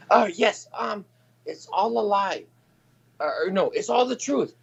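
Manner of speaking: high put-on mom voice; mock mom. Imitating mom vouching for her son. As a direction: play the mom, high and fumbling. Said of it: "mom voiced in story".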